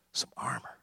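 words dropped to a breathy whisper; Saul's hushed aside. Acted. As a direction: let the warning fade to a whisper. Saul's advice trails to whisper.

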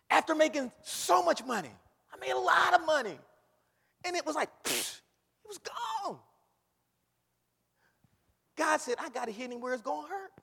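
loud, high, fervent preaching; whooping preacher in full flight; preaching at full force. Acted.

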